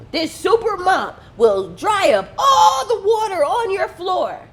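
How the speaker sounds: loud, high hyped-up sales shout; over-the-top TV pitch; infomercial pitchman. Retold or imitated. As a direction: sell it like a late-night infomercial. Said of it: "infomercial at two in the morning".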